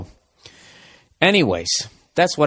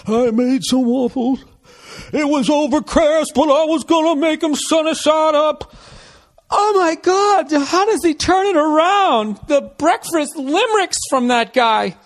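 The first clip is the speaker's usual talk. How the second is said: high, slow crooning parody of a rock ballad; slower than usual. Pretend. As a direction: croon it like a mock heartland rocker. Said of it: "beginning of a [name] song".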